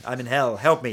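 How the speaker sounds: loud, mock-desperate cat subtitle; imagined cat's plea. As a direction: call it out as a retold plea. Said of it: "the subtitles are going".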